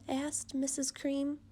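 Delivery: plain reading voice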